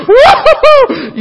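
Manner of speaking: high laughing woo-hoo, drawn out slowly; hammed-up frightened laughing squeal; laughing whoop. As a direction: whoop it up like a scared man laughing. Mock scream at snake, laughing.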